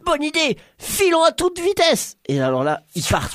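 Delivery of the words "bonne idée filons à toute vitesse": approbation enthousiaste. Acted. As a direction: agree eagerly in a squeaky character voice. Réponse enthousiaste au plan. (petite voix aiguë enthousiaste)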